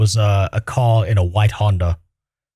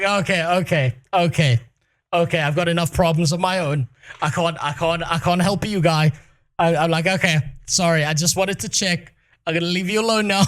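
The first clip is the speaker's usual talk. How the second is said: awkward brush-off; 6 semitones higher than usual. Retold or imitated. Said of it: "And I was like".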